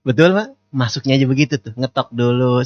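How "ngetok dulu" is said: ordinary talk, nothing marked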